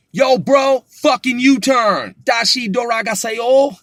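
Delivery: joking overdone yell. Pretend. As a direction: bark the U-turn at your bro as a joke. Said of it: "You wouldn't say it like that".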